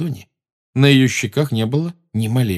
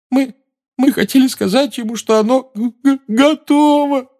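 stammering sob; 4 dB louder than usual. A narrator's reading. Hermione stammering tearfully.

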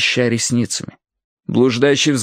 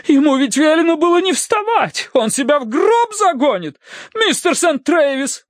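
very high-pitched, indignant alarm; pouncing on him. A narrator's reading. Wife pounces on the young man who let her sick husband up, shrill scolding. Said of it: "Налетела она на Терренса".

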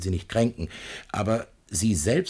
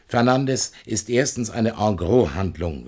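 ordinary delivery

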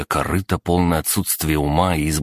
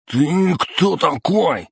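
high, drawn-out thug voice; blurted challenge; 13 semitones higher, 3 dB louder, much slower than usual. A narrator's reading. Thug challenging the stranger at the gas station, blurted high. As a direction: squeal out a challenge, much higher, slowly. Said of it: "выпалил Смуглый".

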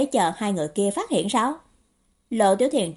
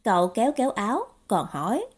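usual speaking voice

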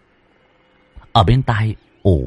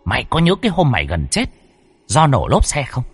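lightly voiced spirit, fast and high; scolding spirit voice; 7 semitones higher than usual. Spirit reminds Tuấn of his near death, fast scolding. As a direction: rebuke him quickly like an old aunt.

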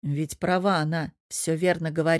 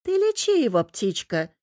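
put-on serious old man; 5 semitones higher than usual. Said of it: "совершенно серьёзно сказал егерь".

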